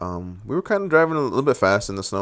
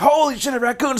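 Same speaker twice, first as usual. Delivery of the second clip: very high, alarmed exclamation; shocked cry. Retold or imitated. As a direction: yelp as the raccoon appears on the road. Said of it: "and I was like".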